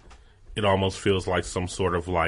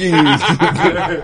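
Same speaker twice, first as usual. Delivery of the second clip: loud laugh; 8 semitones higher, 9 dB louder than usual. Real, not acted. Bursts laughing.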